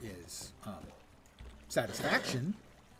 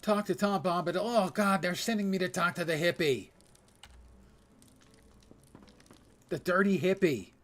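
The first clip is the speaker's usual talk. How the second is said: mocking; 5 semitones higher, 6 dB louder than usual. Real, not acted. Mocking the quest giver.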